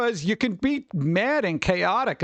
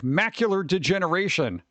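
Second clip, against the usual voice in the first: usual speaking voice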